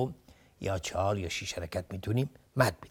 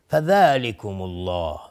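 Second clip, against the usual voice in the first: slow, drawn-out melodic Quranic chanting; Quran recitation; 2 semitones lower, 6 dB louder, much slower than usual. Shown as a demonstration. Tajwid teacher reciting a verse for students, slow chant. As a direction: chant the verse with long held vowels.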